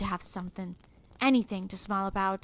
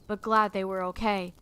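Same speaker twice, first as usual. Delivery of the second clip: normal talking voice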